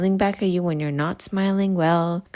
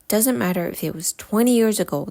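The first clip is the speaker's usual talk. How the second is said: ordinary talk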